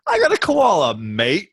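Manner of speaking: cartoon Aussie starting high, dropping on "mate"; mock Australian accent. Joking about how Australians introduce themselves, mock Australian accent. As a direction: ham up the Australian twang. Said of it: "That's what Australians naturally, first thing they say".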